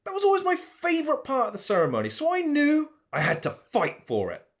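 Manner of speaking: mock outrage.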